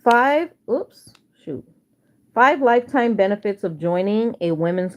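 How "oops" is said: usual speaking voice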